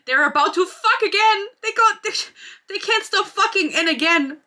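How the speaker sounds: high-pitched, incredulous exclaiming; worked-up, high exasperation; exasperated outburst. Real, not acted. Exasperated outburst at the book's plot.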